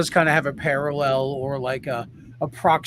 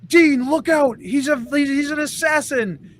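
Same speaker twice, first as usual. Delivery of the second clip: mock warning shout; 8 semitones higher than usual. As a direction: yell out a hammed-up alarm. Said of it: "he's like, he's like".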